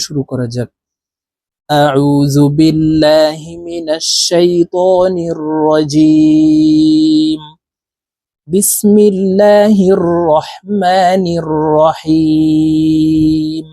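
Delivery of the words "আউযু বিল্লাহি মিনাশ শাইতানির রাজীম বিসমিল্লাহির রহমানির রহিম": slow, drawn-out recitation over music; Quranic recitation. Real, not acted. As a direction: recite slowly, stretching each word. Lesson opens with recitation. (ধীর টানা তিলাওয়াত)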